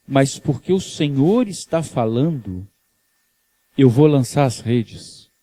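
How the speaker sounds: low and slow, humbly giving in; a fisherman yielding to his master; humble Simon. Acted. Simão cede a Jesus, baixo e humilde.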